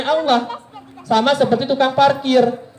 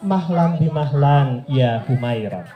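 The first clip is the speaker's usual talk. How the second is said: gentle calming; 8 semitones lower, 3 dB quieter, slower than usual. Retold or imitated. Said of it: "dikatakan Rasulullah kepada Aisyah".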